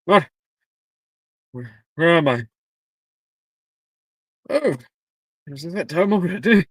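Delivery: halting, bewildered character voice; groggy, confused hat. A narrator's reading. Sorting hat just woken before the first years, groggy confusion. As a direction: come to in fits and starts, baffled.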